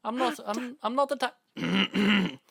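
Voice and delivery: very high, squealed caricature of an Irish YouTuber; Jacksepticeye. Acted. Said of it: "Jacksepticeye does not sound anything fucking like that".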